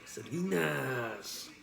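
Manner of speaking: "Selena's" very slow, with a long final S; S, not Z. Retold or imitated. Echoing the film brother's line, drawn-out "[name]" with final S. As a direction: stretch out "Selena's" and end on sss, not z. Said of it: "He said it with an S".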